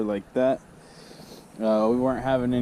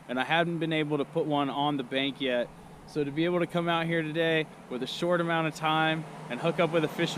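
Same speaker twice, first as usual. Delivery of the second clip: higher, upbeat ad read; sponsor outro; 6 semitones higher than usual. Acted. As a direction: wrap up the vlog cheerfully.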